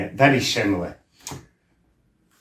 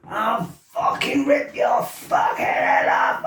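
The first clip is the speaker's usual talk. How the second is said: much higher, slow roared threat; furious shout; 11 semitones higher, slower than usual. Retold or imitated. Lad describing the sudden flip into rage. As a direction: hammer every word in rage.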